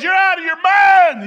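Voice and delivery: high, drawn-out yell back down; acted sailor hollering in disbelief; incredulous shout.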